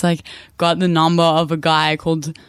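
ordinary talk, nothing marked

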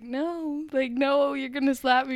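high, loud mock pleading; panicked refusal. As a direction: protest like a kid dreading punishment. Daughter pleading away from slap.

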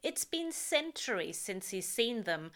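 plain reading voice

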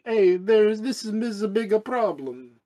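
high, hammed-up Italian accent; Italian accent, exaggerated for laughs; mock Italian accent. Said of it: "he's Italian, so he'd say".

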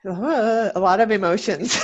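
fighting back tears, laughing nervously; holding back tears. Real, not acted. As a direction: voice catching with emotion. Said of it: "I'm trying not to cry".

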